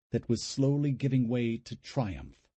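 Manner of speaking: plain reading voice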